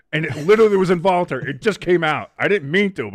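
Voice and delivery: ordinary talk, nothing marked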